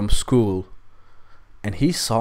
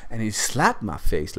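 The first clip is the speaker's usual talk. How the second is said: plain reading voice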